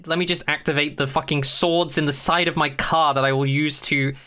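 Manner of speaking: ordinary talk, nothing marked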